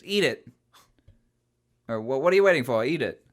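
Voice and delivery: barked loud order to eat; gruff command. Retold or imitated. Mocking the partner ordering kids to eat, loud and gruff. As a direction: push it on them like a thug. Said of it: "he goes".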